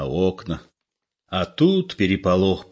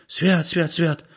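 high, hushed frightened prayer; frightened old woman crossing herself; 9 semitones higher, slower than usual. A narrator's reading. Old woman terrified by the murder story, hushed high prayer. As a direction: mutter it crossing yourself in fear. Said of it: "закрестилась старуха".

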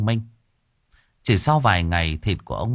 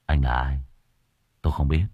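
low, cold and flat; 6 semitones lower than usual. Acted. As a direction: answer icily. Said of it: "An lạnh lùng".

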